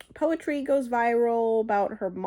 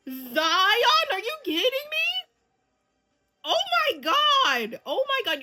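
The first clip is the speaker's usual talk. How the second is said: high, incredulous exclamations; astonished; 12 semitones higher, 4 dB louder than usual. Real, not acted. Viewer astonished that Zion turns up, incredulous. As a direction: let the voice climb high in disbelief.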